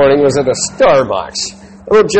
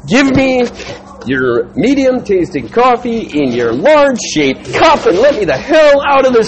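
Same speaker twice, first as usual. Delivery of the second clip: higher, slowed exasperated order; fed-up demand; 7 semitones higher than usual. Retold or imitated. Customer to barista, exasperated and deliberate. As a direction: spell it out, fed up.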